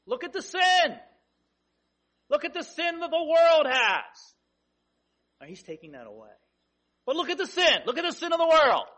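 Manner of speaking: "sin" and "world" punched loud and high; stress on SIN and WORLD. Shown as a demonstration. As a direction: hammer home the sin of the world. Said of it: "That's the one emphasis".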